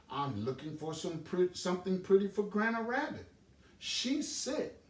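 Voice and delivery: put-on a small child full of his plan; eager Little Rabbit. Little Rabbit tells Mama Oriole his plan, high and eager.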